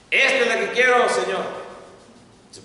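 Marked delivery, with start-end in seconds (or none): 0.1-1.4 loud, fast, eager pick of the cross; eager claim. Retold or imitated. Man tells the Lord, excitedly loud. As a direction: grab the little cross, thrilled. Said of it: "va y busca ese hombre".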